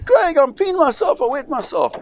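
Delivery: put-on very high, whimpering scared boy voice; squeaky high child, wetting himself in fear; panicked kid. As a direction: imitate a mortified terrified boy. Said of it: "He says".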